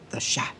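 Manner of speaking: "chat" said softly, French style with a sh sound; French accent. Shown as a demonstration. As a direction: whisper "chat" the French way, "shah". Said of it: "with a little French accent over the C".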